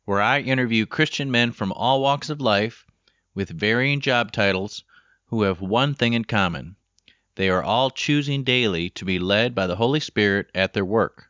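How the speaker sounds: upbeat announcer-style show opener; podcast intro read. Acted. Recorded podcast opener over music.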